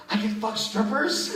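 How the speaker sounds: high, slow incredulous question; amazed disbelief. Retold or imitated. Drunk man learning strippers say yes, high-pitched amazement. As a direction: squeal it in mock astonishment. Acting out own amazed discovery.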